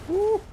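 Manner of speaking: loud high whoop; excited whoop. Real, not acted. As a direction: let out a big woo.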